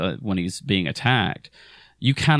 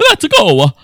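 loud, high burst of cartoon Italian; mock Italian Mario; 10 semitones higher, 12 dB louder than usual. Mocking Pratt's switch from normal talk, loud Italian Mario shout. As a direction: suddenly blurt it in hammed-up Italian. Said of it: "all of a sudden become Italian".

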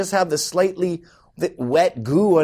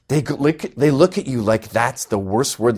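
usual speaking voice

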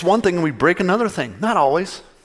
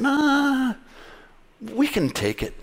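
cocky dismissal; 10 semitones higher, 4 dB quieter, much slower than usual. Acted. Preacher voices people dismissing God.

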